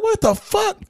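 very high, incredulous question; squeaky mock astonishment; retold disbelief. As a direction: let the pitch leap up in baffled disbelief. Retold disbelief at man walking away.

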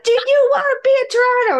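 loud and very high, fast lead-in; hammed-up coach yell. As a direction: bellow the question in his face. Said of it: "the idiot saver's like".

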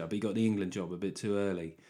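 usual speaking voice

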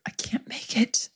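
whispered, almost no voice; desperate whisper. Real, not acted. Lover confessing she's on the edge, desperate whisper. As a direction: breathe it out in frustration.

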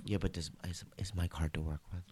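hushed gossip voice; whispering. Real, not acted. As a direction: whisper it like a secret. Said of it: "Why are we whispering?".

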